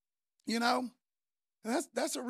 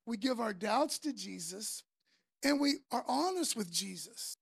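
ordinary talk, nothing marked

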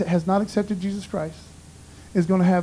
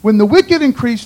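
the verse boomed out louder, preacher style; loud emphatic; 2 semitones higher, 7 dB louder than usual. Shown as a demonstration. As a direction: proclaim it with full force. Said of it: "So anywho".

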